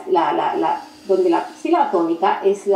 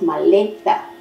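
"maleta" stressed on the middle syllable, LE; 3 semitones lower, slower than usual. Shown as a demonstration. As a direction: say "maleta" as ma-LE-ta, emphatically. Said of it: "tiene el énfasis".

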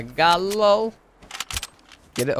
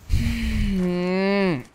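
exasperated sigh; 6 semitones higher than usual. Real, not acted. Sigh after annoying teammate.

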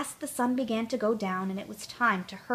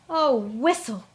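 dismayed cry; 3 semitones higher, 4 dB louder, slower than usual. A narrator's reading. Tootle at the great curve, a dismayed cry. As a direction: gasp it as the curve appears. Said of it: "cried Tootle".

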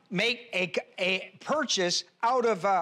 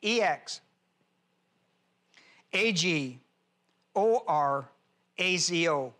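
spelled letter by letter in pairs; spelling out. Shown as a demonstration. Teacher spelling a word for note-takers, spelling out. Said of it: "spell it to you".

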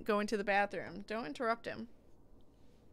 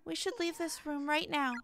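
higher-pitched character voice; worried game character; 8 semitones higher, 5 dB quieter than usual. Acted.

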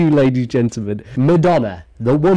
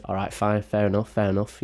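lower, softer, grudging concession; mock giving in; 6 semitones lower, 9 dB quieter than usual. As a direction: mum backing down. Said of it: "She goes".

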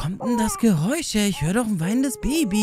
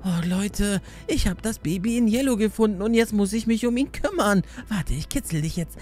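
ordinary delivery

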